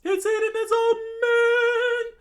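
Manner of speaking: very high loud shriek; comic shriek; screamed. Acted. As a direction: shriek it in horror. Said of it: "Sanji screamed out".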